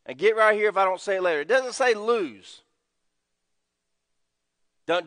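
ordinary talk

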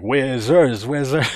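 high, sing-out mock yell; mock screaming. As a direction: imitate screaming kids without really yelling.

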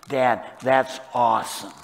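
hint of an impressed kid, loud emphasis; impressed teenager. Father mimicking his teenage kids, lightly voiced loud approval. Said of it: "When they were teenagers, they would say".

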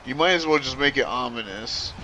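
usual speaking voice

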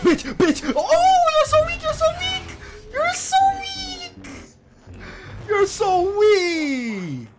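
very high, loud, drawn-out screams; howling rage yell that cracks; furious screaming. Real, not acted. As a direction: scream down the opponent. Raging at opponent.

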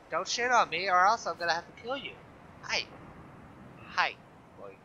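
normal talking voice

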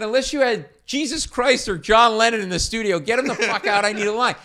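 loud, high, angry demand; snapped. Retold or imitated. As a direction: tear into the intern. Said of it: "I snapped and I went".

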